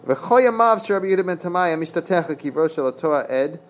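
high-pitched Hebrew text reading; reading sacred text aloud, pitched well up; Talmud text recitation. Acted.

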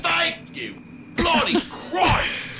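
high, slow, fed-up yell; exasperated shout. Acted. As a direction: throw up your hands, yelling.

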